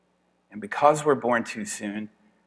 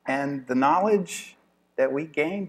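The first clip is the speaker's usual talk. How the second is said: ordinary delivery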